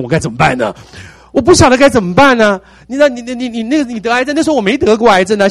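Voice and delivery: loud, high, exasperated protest. Real, not acted. Pastor venting to the congregation, loud and exasperated. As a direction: throw up your hands and shout it out. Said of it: "可是我不会解决啊".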